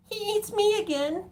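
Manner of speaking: very high, slow ghostly spirit voice with a giggle; playful spirit voice. Pretend. Reader voicing the Old Spirit teasing viewers, very high and slow. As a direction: squeak it out like a mischievous ghost. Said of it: "Old Spirit is playing a little trick on us".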